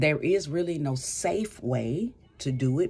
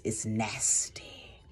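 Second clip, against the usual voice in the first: disgusted whisper; 4 semitones lower, 5 dB quieter, much slower than usual. Real, not acted. Condemning malicious hurtful behaviour.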